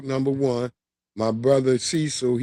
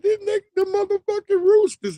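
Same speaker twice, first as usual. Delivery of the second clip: put-on high, sobbing kid's wail; crying kid naming the roosters. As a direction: blubber it through tears. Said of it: "Man, I'm crying and shit".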